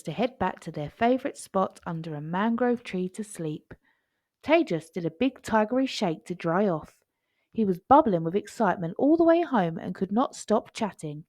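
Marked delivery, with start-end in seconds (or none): none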